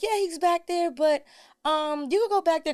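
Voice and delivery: put-on high, casual child voice; little girl voice. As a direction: answer like a distracted kid. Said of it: "she was like".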